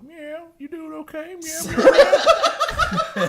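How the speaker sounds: high cooing meows; mock cat baby talk. Sister meowing on the phone to her cat, high cooing. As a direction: coo at the cat like a little girl. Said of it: "she'd go".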